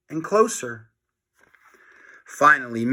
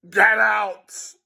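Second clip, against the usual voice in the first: higher, louder angry principal; read-aloud principal yelling; mock scream; 7 semitones higher, 4 dB louder than usual. A narrator's reading. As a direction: bark him out the door. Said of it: "Mister Krupp screamed".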